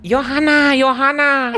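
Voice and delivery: high, loud calling of Johanna; imitating a mom's accent. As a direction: call Johanna like a mother.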